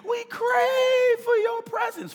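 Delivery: mock desperate prayer. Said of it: "I'm not just craving like".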